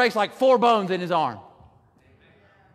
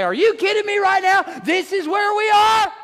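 exasperated prayer; 8 semitones higher than usual. Retold or imitated. Preacher showing how he complains to God, high and exasperated. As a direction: complain to God like a best friend. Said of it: "I look up to heaven and say".

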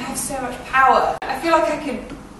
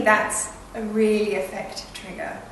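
normal talking voice

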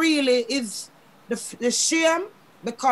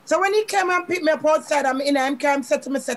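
ordinary talk, nothing marked

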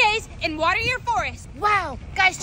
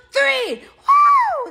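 excited cheer; 8 semitones higher, 7 dB louder than usual. Acted. Throws snowball, cheering.

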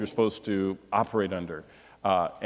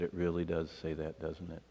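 low, hushed retold concession; subdued admission; 7 semitones lower, 11 dB quieter than usual. Churchgoer to pastor after reading verse, subdued. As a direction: imitate someone quietly conceding, voice dropped right down.

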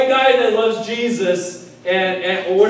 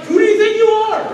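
much higher and louder, an offended snap; indignant retort; 12 semitones higher, 5 dB louder than usual. Retold or imitated. Stranger at Target criticizes your clothes, indignant high retort. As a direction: fire it back at a rude stranger. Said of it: "Then you're like".

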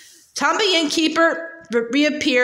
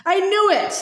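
higher, gleeful cry of discovery; gleeful shout; 7 semitones higher than usual. Acted. Stan realizes the boy is Harry Potter, gleeful shout. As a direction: burst out with delight at being right. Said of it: "Stan shouted gleefully".

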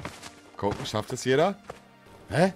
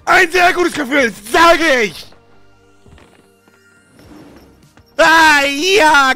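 high loud gloating cheer; triumphant; 8 dB louder, slower than usual. Real, not acted. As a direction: crow in victory.

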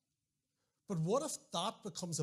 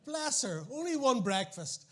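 higher, louder and slow, hamming up the sympathy; teasing his wife with mock sympathy before the congregation; mock pity; 6 semitones higher, 6 dB louder, slower than usual. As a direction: stretch out only one breakfast like a tragedy. Mock pity for wife's hunger.

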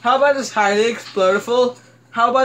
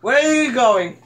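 raised, playful scolding with laughter; indignant calling out; 5 semitones higher, 3 dB louder than usual. Pretend. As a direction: call after a lifeguard who's deserting. Scolding game lifeguard walking away.

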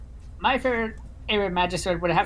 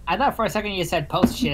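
ordinary delivery

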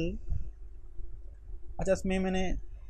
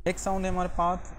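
normal talking voice

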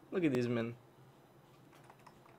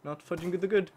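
ordinary talk, nothing marked

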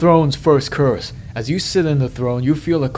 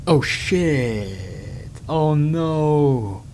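real dismay; much slower than usual. Real, not acted. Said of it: "I wasn't reading carefully".

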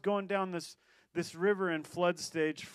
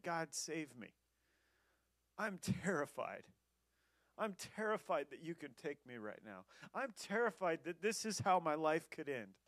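much softer, hushed plea; scared prayer; 8 dB quieter than usual. Retold or imitated. Stranded man praying to God, soft fearful plea. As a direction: pray in a small frightened voice. Frightened prayer on log jam.

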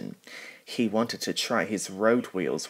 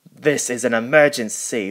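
stern, much louder boss voice; cross; 4 semitones higher, 8 dB louder than usual. A narrator's reading. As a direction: scold the engine sharply. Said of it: "said to Flynn crossly".

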